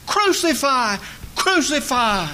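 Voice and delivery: high, loud mock crowd shout of "Crucify, crucify". Preacher voicing the crowd demanding crucifixion, high mock shout. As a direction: cry "Crucify" as a mob would, pitched high. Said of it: "as loud as they could shout".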